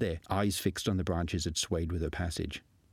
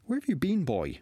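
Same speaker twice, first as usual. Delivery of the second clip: put-on curious old master; 11 semitones higher, 3 dB louder than usual. A narrator's reading. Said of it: "more curious than irritated".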